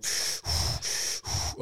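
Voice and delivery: rasping mechanical breath; life support machine breath. Shown as a demonstration. Describing a life support machine, mechanical rasping breath. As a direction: breathe like a respirator pumping. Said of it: "one of them things that go".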